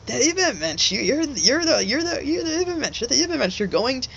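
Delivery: high, manic imitation of a wild prophet; imitating a crazed philosopher insisting; crazy Nietzsche way. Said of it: "'cause he was saying it in his, like, crazy Nietzsche way".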